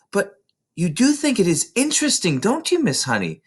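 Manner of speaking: put-on high, bright child voice tumbling with excitement; eager little girl. A narrator's reading. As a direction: play Matilda bursting to tell her teacher. Matilda pleading with teacher.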